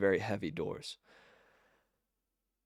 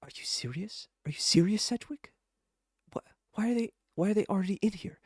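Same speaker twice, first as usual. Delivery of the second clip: high-pitched incredulous panic, rising as it goes; frantic disbelief, pitched well up; 12 semitones higher, faster than usual. Acted. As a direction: demand answers in a frantic, higher voice. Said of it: "some kind of frantic".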